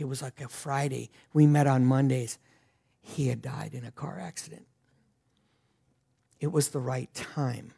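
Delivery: somber hush. Real, not acted. Telling the church the guest died, softly somber. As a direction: let the loss sit quietly. Tells of guest's sudden death.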